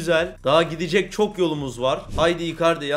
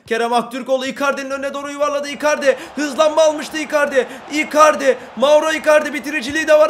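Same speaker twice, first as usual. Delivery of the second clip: rising, louder play-by-play shouting the striker's name; excited commentary; 7 semitones higher, 6 dB louder than usual. Real, not acted. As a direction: cheer the attack as the chance builds. Heyecanlı maç anlatımı, gol pozisyonu. (coşkulu maç anlatımı)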